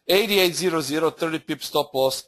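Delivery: ordinary talk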